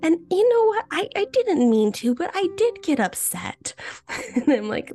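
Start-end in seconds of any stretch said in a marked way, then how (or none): 0.0-3.8 put-on much higher little-girl voice; high-pitched imitation of a kid owning up; Ella. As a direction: imitate a cute child earnestly confessing. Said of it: "she's the cutest thing ever".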